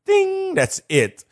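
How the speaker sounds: high, slow sung-out ding; mock ding. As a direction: ring it out like a bell. Preacher mimics a bell sound.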